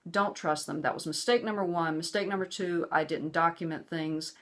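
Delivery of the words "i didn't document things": normal talking voice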